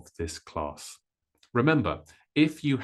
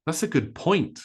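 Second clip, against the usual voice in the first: "point" punched high and long, "good" lighter; stress on point; 6 semitones higher than usual. Shown as a demonstration. Teacher modelling sentence stress to learners, stress on "point". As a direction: race through "That's a" and land loudly on "point". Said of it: "point becomes loud and long".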